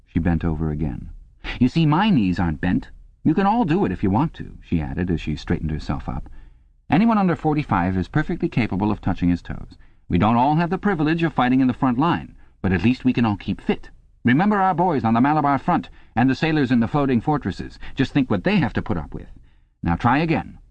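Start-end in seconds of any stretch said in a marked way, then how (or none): 1.6-4.6 high-pitched, urging instructress; brisk exercise instructress. A narrator's reading. Instructress shows her straight knees, high and urging. 6.9-20.6 high-pitched patriotic pep talk; exhorting instructress. A narrator's reading. Instructress invokes soldiers to spur the class, high and exhorting.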